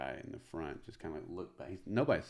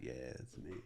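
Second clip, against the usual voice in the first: soft, low reply; bashful admission; 5 semitones lower, 9 dB quieter than usual. Retold or imitated. Comic answers old lady's recognition, bashful and quiet. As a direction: own up to it quietly.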